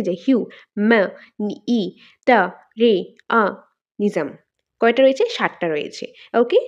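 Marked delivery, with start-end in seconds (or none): 0.3-4.3 "হিউ, মে, ই, টা, রে, আ, Nizam" with a clear pause after each piece; syllable by syllable. Shown as a demonstration. Teacher counting seven syllables, "হিউ, মে, ই, টা, রে, আ, Nizam" said piece by piece. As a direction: give "হিউ, মে, ই, টা, রে, আ, Nizam" as seven isolated beats. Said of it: "তো কয়টা রয়েছে".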